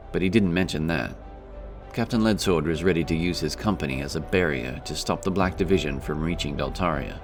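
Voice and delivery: plain reading voice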